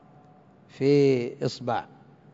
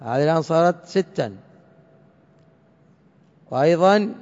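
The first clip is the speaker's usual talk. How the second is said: ordinary talk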